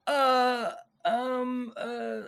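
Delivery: high-pitched, lost-for-words hesitation; nervous stammering. Retold or imitated. Young writer accused by his hero, nervous stammering.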